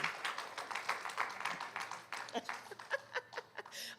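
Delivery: high airy laugh through tears; tearful laugh. Real, not acted. Speaker thanking the representative, tearful laugh. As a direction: laugh helplessly while welling up. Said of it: "I got tears in my eyes".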